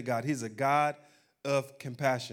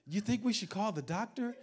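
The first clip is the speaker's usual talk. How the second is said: much higher, anxious wife voice; worried wife; 7 semitones higher, 3 dB quieter than usual. Retold or imitated. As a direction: ask it fretting over the baby.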